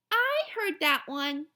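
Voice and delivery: drawn-out know-it-all whine; little girl already knows it, slowly; bored little girl. Acted. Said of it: "Jenny said".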